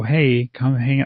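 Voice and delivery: usual speaking voice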